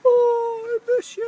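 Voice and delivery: mock wail in a very high voice; Beverly. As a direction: imitate a friend's over-the-top goodbye, drawn out high. Imitating Beverly's goodbye.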